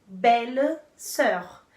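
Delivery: belle-sœur drawn out slowly and clearly. Shown as a demonstration. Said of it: "eu vou ter". (belle-sœur bem devagar e claro)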